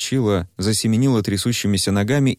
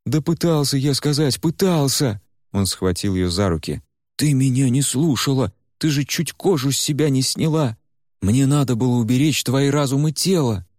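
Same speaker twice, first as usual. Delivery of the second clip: higher, exasperated protest; Куйгарош heated; 6 semitones higher than usual. Acted. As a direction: defend himself hotly. Куйгарош defends himself. (возмущённый голос Куйгароша)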